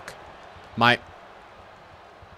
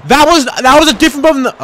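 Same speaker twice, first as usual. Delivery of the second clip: much higher and louder frantic complaint; exasperated protest; 13 semitones higher, 14 dB louder than usual. Real, not acted. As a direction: protest the controls loudly. Protesting wrong button pressed.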